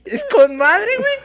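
laughing through the words. Real, not acted. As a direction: breaks into laughter as he speaks. Laughing while recalling concert.

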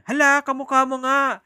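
loud, high excited cry; retold shout. Stranger shouting at a student on the street, loud. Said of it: "Tas nagsisigaw siya".

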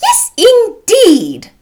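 very high, loud, drawn-out indignation; offended cry. A narrator's reading. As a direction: exclaim as an affronted matron. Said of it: "offended by his manner".

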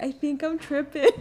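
high-pitched whine, half laughing; mock crying. Tripping storyteller tearfully confesses to her cousin, imitated high crying voice. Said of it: "Started fucking crying".

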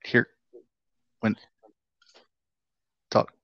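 choppy, glitchy call audio that drops words; words breaking up like a bad connection; cutting out. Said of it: "You're cutting out".

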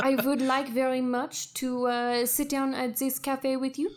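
put-on French-accented holographic woman. French Beth admires visitor, disdains Americans, French accent. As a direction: speak English as a native of France would. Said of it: "looks like she is a native in France".

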